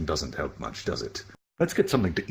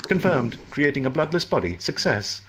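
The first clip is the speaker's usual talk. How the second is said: higher, even, emotionless system announcement; mechanical inner voice; 8 semitones higher than usual. Acted. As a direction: report like a machine. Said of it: "what's this you've been going on about".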